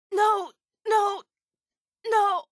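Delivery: high-pitched cries of no; distressed cry. Acted. Fighter crying out mid-fight, distressed high cries. Combat outcry, being hurt.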